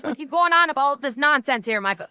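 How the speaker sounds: put-on Newfie accent. Streamer mimicking self upset watching videos, in a Newfie accent. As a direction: keep ranting in hammed-up Newfie. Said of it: "the Newfie comes out of me".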